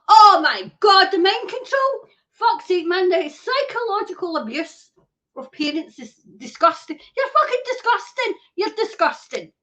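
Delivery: loud, high disgusted outburst, slowing on the first words; furious rant. Real, not acted. Raging at officials over abuse of parents, furious shout. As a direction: pour out real disgust at them. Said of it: "I'm so fucking angry".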